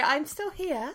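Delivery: breaking up over the connection; bluetooth cutting out. Real, not acted. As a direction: voice dropping out on a bad line. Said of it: "You're just dropping out".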